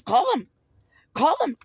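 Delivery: re-enacting a shouted dare; defiant dare. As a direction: dare him loudly, high and forceful. Dares him to call police.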